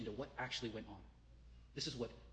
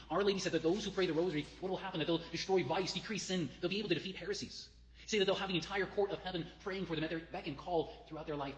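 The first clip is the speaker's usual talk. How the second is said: raised, driving sermon voice; fervent preaching; 5 semitones higher, 5 dB louder than usual. Acted. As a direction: push each promise out to the congregation. Preacher drives home the promises.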